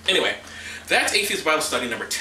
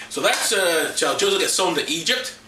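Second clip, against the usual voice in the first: usual speaking voice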